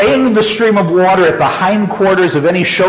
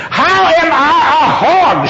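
high, drawn-out indignant question; demanding; 11 semitones higher than usual. A narrator's reading. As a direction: demand it slowly, pitched right up. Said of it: "she demanded".

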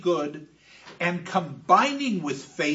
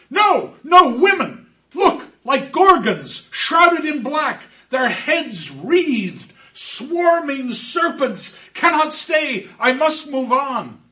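a terrified shout, much higher and louder; howling in terror; 9 semitones higher, 10 dB louder, slower than usual. Acted. Orestes howls at the Furies closing in, terrified shout. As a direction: scream at horrors nobody else can see. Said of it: "And he howls".

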